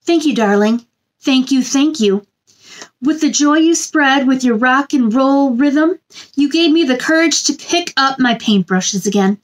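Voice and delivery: gentle sing-song picture book reading; storybook read-aloud. A narrator's reading. Reading a picture book to children, sing-song storybook voice. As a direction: read it like bedtime story time for little ones.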